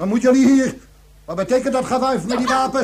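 loud, high demand to the armed intruders; loud startled confrontation; alarmed challenge. Acted. Soldiers burst in with weapons.

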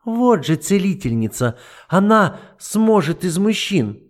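high, slow eager pointing out; a desperate flash of hope; hopeful. Acted. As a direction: seize on a hope. Matilda offers Frederika.

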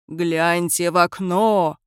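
slow, eerie villain voice; creepy villain voice. Acted. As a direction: drag it out like a sinister villain. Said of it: "сказал Николас жутким злодейским голосом".

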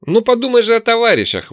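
loud, high-pitched plea; exclaiming elf. A narrator's reading. Elf pleading with the orc to share, high exclamation. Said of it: "воскликнул эльф".

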